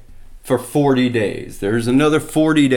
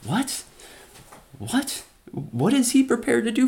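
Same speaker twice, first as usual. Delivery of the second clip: incredulous; 9 semitones higher, 5 dB quieter than usual. Retold or imitated. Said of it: "And David's like".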